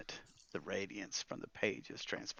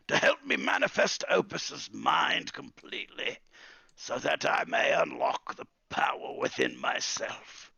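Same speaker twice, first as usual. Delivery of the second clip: put-on creaky old spellbook master, intent; old wizard Oglethorpe, scheming; 7 semitones higher, 6 dB louder than usual. Wizard telling Calamus of the spellbook he needs, scheming old man.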